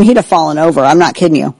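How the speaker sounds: normal talking voice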